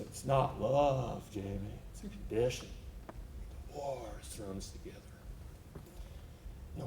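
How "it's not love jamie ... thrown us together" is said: much softer, low, resigned confession; quiet and resigned. Retold or imitated. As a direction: let it sink almost to nothing. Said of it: "He said".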